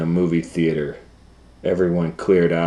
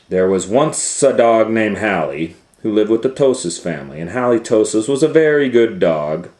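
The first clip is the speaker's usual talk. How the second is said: bright, raised read-aloud voice; storybook narration; 5 semitones higher, 7 dB louder than usual. Acted. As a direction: read it like a bedtime story opener.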